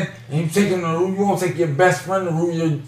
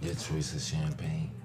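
hushed; 13 semitones lower, 18 dB quieter than usual. Real, not acted. Hushed advice continues.

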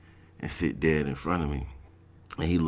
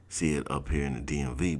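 usual speaking voice

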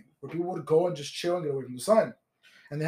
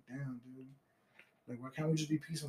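dazed mutter; 4 semitones lower, 13 dB quieter than usual. Retold or imitated. Stoned onlooker's dismayed reaction.

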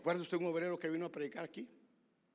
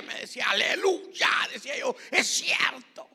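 high, loud, exultant pulpit shout; loud, rousing preacher's delivery; full-force preaching; 8 semitones higher, 11 dB louder than usual. Real, not acted. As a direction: shout the testimony to the congregation.

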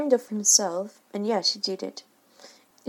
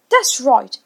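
loud sarcastic shout; 3 semitones higher, 9 dB louder than usual. A narrator's reading. Father jeering at Wendy hugging the dog, loud sarcastic shout. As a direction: yell it, sneering. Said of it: "he shouted".

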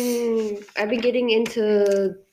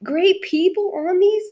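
high, drawn-out disbelief; amazed fan; 11 semitones higher, 3 dB louder, slower than usual. Retold or imitated. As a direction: gush in stunned excitement. Gushing over album's guest singers.

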